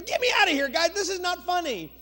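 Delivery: high, loud cry for help; retold screaming. Blindfolded freshman tied to the track, screaming at the guys. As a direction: yell it out like the panicked freshman. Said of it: "he started screaming".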